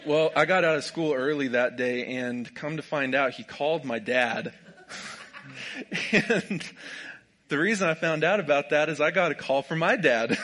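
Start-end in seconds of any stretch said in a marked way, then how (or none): 4.6-6.1 airy, breathless laugh with no voice; silent laugh. Real, not acted. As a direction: wheeze with laughter. Teacher called his dad.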